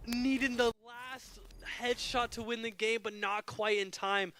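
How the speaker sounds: high, drawn-out excited call; excited, slowing down. Real, not acted. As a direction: stretch the words, still hyped. Caster winds down after clutch.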